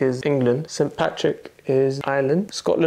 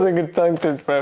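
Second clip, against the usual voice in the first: ordinary delivery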